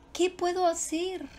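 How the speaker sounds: "qué puedo hacer" asked on a high pitch; interrogative. Shown as a demonstration. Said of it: "Es interrogativo".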